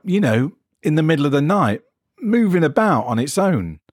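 a worried man fumbling for words; anxious patient. Acted. Jeff nervously describing his arm.

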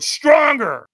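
imitating a shouting coach; trainer's scream. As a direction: push them harder with a shout. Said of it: "So he screamed at me".

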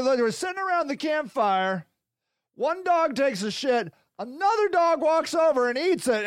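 ordinary delivery

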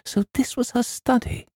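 put-on very high, awed girlish question; cry of delight. A narrator's reading. Girl admires the aunt's study, delighted. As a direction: marvel in a high girl's voice. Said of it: "she cried".

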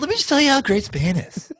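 high gushing voice with a laugh; study-abroad girl. Retold or imitated. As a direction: gush about Spain like a study-abroad girl.

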